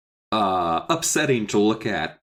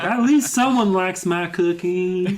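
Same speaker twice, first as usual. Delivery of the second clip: high, drawn-out character voice; slow cartoonish high voice; Alex; 7 semitones higher, slower than usual. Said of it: "Alex is just like".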